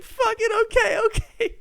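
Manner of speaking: half-laughing frightened squeal; panicked girlfriend. Retold or imitated. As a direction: squeal it like you're cornered. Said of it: "And Nia's like".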